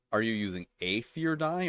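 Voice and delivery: "a" said as a full "ay", set apart; stress on A. Shown as a demonstration. Reader contrasting dice wordings, "a" stressed as "ay". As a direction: lean on "a" as "ay", ending in a question. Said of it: "this is why there's important distinctions".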